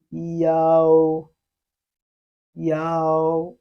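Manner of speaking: loud, drawn-out yao for students to copy; Chinese yao. Shown as a demonstration. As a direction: model yao clearly. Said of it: "The E sound must be read".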